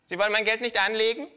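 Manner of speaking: high, loud cheeky kangaroo voice; Känguru-Stimme. Acted. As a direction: play the brazen kangaroo, up high. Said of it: "hört abrupt auf zu lachen". (hohe, laute Känguru-Stimme)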